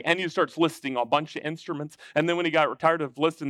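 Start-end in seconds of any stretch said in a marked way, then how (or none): none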